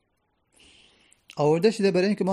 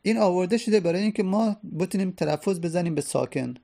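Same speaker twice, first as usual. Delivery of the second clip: usual speaking voice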